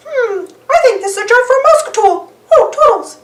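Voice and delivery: high, quick cartoon mouse; Mickey voice. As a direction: think aloud then call out. Mickey calls his helper.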